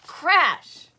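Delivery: loud, high shouted crash sound effect. A narrator's reading. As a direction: bellow the crash like a storyteller. House fence crashes down.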